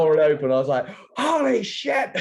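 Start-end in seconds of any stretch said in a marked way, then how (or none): 1.2-2.1 high-pitched cry of astonishment; imitating a stunned kid's exclamation; retold amazed outburst. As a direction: blurt it high, in hammed-up amazement. Retold reaction on opening present.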